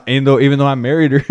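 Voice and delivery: ordinary delivery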